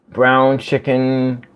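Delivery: slow, careful "Brown chicken"; "Brown chicken" said slowly and deliberately; slow tongue twister. Shown as a demonstration. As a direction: drag out "Brown chicken" as if testing it. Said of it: "Say it fast".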